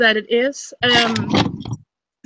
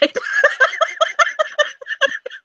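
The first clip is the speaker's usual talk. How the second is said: high laugh. Real, not acted. Laughing at friend's comeback.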